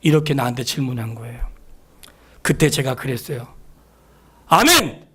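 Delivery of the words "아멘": fervent amen. Retold or imitated. Preacher's answer to God.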